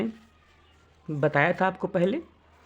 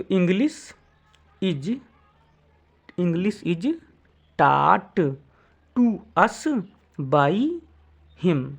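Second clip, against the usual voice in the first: word by word, with gaps; slow dictation; much slower than usual. Shown as a demonstration. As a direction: dictate slowly for students to write. (धीरे-धीरे बोलकर लिखवाना)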